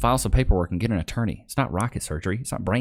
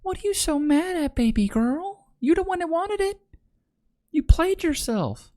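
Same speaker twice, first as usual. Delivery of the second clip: mock high voice; 13 semitones higher than usual. Mocking high voice at woman.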